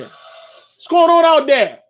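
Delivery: loud, high, drawn-out holler; calling out. Real, not acted. Host hollers at a noise out there, calling out loudly. Calls out again, much louder.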